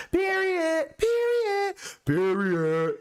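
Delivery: very high, punchy repeated Period; mock girls' period. Mimicking girls co-signing with period, very high mock voice. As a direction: squeal out Period three times.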